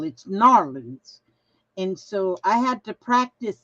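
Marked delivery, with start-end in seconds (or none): 0.0-1.2 It's Narlands, slow, stressing NAR-lands; correcting pronunciation. Retold or imitated. Somebody correcting her, slow Narlands. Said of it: "Then somebody said".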